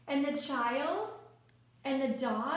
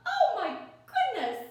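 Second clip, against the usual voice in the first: higher and louder Oh my goodness; surprised; 8 semitones higher, 5 dB louder than usual. Acted. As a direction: exclaim it in amazement. Chaos in picture book.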